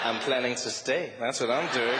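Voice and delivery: low, flirtatious reply; flirting back. Acted. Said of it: "being flirted with".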